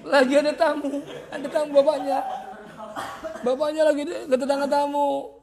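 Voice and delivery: laughing loudly through the story; laughing retelling. Retold or imitated. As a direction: chuckle while telling it. Retelling embarrassed father, laughing.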